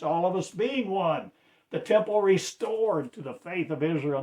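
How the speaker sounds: high, loud proclaiming; fervent loud proclamation; preaching climax. Real, not acted. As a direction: rise to a fervent peak. Sermon climax.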